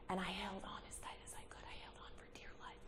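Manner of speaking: breathless hush, holding tight; rushed whisper while gripping the wheel. Retold or imitated. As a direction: whisper it as if bracing for impact. Retelling bracing for the crash.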